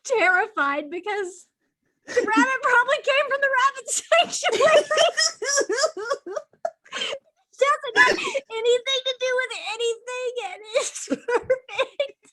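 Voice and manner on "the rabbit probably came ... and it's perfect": talking through high, loud laughter; giggling fit, voice up high; helpless laughter. Real, not acted. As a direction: laugh so hard the words crack. Laughing hard at the story.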